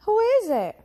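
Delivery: squeaky who is it; eager question. A narrator's reading. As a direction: ask with wide-eyed excitement. Curious excited question.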